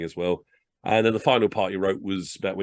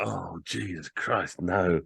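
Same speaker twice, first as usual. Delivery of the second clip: despairing groan; 4 dB quieter, much slower than usual. Retold or imitated. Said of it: "they all go".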